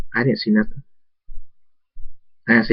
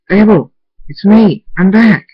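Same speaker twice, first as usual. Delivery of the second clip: loud, high searching call; 11 semitones higher, 8 dB louder than usual. A narrator's reading. Father back home calling hidden Abel, calling out. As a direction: call out to someone hiding.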